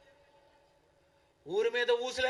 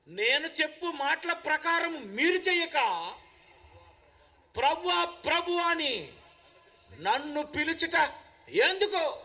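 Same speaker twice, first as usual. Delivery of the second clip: higher, measured pulpit proclamation; scripture declaimed in preaching; 6 semitones higher, 4 dB louder than usual. Acted. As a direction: declaim the verse as a stern challenge.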